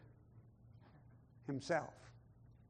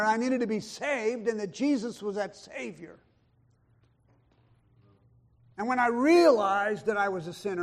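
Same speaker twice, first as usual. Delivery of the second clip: forceful testimony with punched, raised key words; impassioned preaching; 3 semitones higher, 7 dB louder than usual. Real, not acted. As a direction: preach your conversion with rising conviction.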